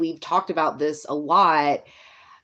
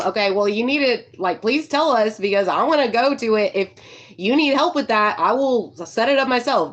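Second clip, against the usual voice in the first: excited pleading; 6 semitones higher, 3 dB louder than usual. Retold or imitated. Excited plea to band.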